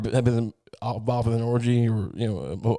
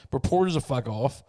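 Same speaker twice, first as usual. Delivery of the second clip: normal talking voice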